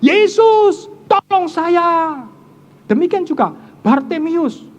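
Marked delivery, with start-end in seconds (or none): 0.1-2.2 high, loud cry for help, tolong drawn out slowly; a panicked yell, high and loud, slowing on tolong saya. Retold or imitated. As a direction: shout to Jesus as if about to crash. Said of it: "Dia akan berteriak".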